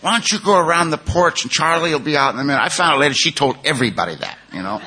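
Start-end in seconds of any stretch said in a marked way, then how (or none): 0.0-2.6 higher, brisk, friendly invitation; a hint of the sponsor's chirpy wife; lightly voiced wife. Sponsor's wife sends the visitor round the porch, lightly voiced and chirpy.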